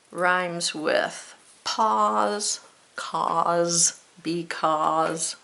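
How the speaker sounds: "pause, cause, because" very slow, each ending in kawz; careful pronunciation. Shown as a demonstration. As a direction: stretch out "because" so it rhymes with "pause". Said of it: "You gotta say it the right way".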